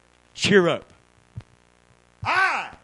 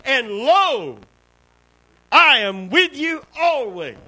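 booming Jesus quote; 8 semitones higher, 6 dB louder, slower than usual. Acted. Preacher quoting Jesus, raised voice.

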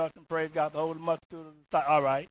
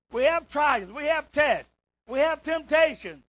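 rising, louder preaching cry building up; preaching shout; 6 semitones higher, 4 dB louder, slower than usual. Real, not acted. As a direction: climb to a shouted peak, line by line.

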